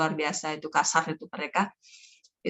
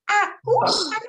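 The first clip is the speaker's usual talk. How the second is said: put-on much higher, small childlike voice; little child voice; 4 dB louder than usual. Shown as a demonstration. Storyteller demos a child character's voice to teachers, high and small. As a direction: speak like a tiny child. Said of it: "Coba semuanya".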